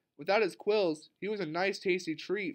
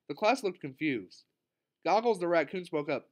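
ordinary delivery, nothing marked

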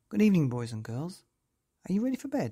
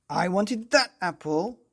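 a cross, raised shout; 5 semitones higher, 6 dB louder than usual. Acted. Marvin shouting at Molly again over the apple, raised voice. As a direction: demand it crossly. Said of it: "shouted Marvin".

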